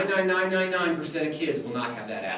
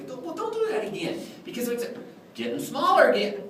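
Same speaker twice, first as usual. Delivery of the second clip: high-pitched clownish reprimand; mock scolding; 13 semitones higher than usual. Clown blaming the kid for the wand, mock scolding. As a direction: scold in a high, fretful pretend panic.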